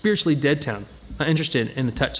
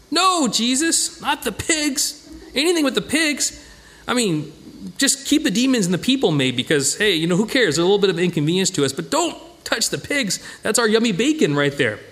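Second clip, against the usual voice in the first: whiny pig owner; 6 semitones higher than usual. Pretend. Pig owners pleading with Jesus to spare their pigs, high comic whine. Townsfolk voiced begging about pigs.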